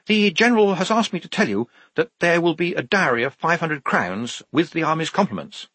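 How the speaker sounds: high-pitched officer's voice; Major Clogston, official. A narrator's reading. Officer passing on the General's offer to Johnny, formal and high-pitched. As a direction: deliver an official message in a high voice.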